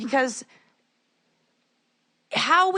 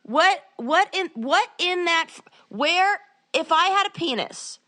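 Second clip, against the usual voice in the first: ragey mad; 6 semitones higher than usual. Real, not acted. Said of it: "I'm getting ragey mad".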